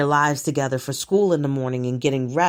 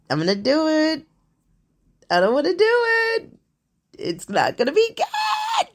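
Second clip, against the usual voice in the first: hammed-up distress, pitched far higher; mock panic; 7 semitones higher than usual. Vlogger feeling Karen-like, acts out a hammed-up meltdown. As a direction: beg for help in a melodramatic whine, playing it up.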